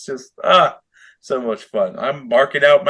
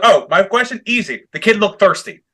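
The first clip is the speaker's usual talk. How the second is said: imitating MJF; 6 semitones higher than usual. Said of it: "And then he was like".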